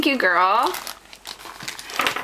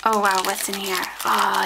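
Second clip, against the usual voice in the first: ordinary talk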